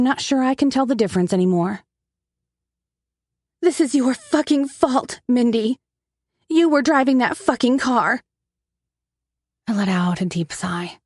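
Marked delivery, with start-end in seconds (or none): 3.6-8.2 higher, heated accusation; angry blame. A narrator's reading. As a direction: hurl the blame at her sister. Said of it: "erupts in anger and blames me".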